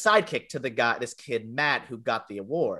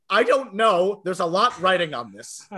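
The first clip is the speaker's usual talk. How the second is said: higher, louder flustered reply; 6 semitones higher, 6 dB louder than usual. Retold or imitated. A kid at the counter confused by the candy choice, flustered. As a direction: sound thrown off and overwhelmed. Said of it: "I go".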